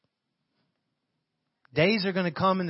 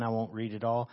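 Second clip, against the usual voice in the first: ordinary talk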